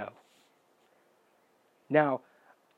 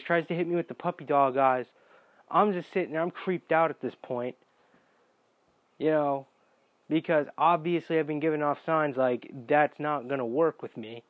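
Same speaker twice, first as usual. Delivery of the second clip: usual speaking voice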